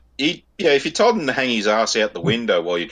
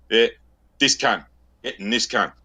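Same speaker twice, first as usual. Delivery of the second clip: ordinary delivery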